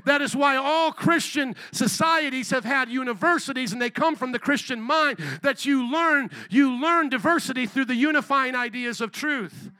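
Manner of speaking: loud, fiery preaching; rally speech. Acted. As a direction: preach it full force to the crowd. Preaching at full force, rally peak.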